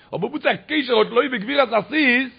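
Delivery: high, insistent preacher's delivery; preaching. Acted. Preacher questioning the verse for his listeners, high insistent preaching.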